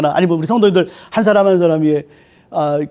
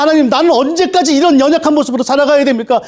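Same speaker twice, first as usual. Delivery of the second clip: higher, louder crying out to God; 8 semitones higher, 4 dB louder than usual. Retold or imitated. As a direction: cry out in anguished pleading. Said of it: "부르짖음".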